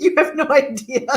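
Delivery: laughter bubbling through the words; laughing. Real, not acted. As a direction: say it while cracking up. Laughing after her story.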